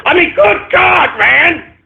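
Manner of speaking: high, drawn-out cry of exasperation ending in a shout; good God, fed up, voice cracking high; exasperated outburst. Real, not acted. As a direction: throw up your hands and yell it. Exasperated at trolling donations.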